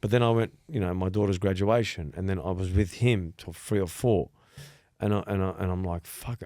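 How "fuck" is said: mock weary sigh. Retold tired groan.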